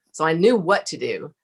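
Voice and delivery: ordinary delivery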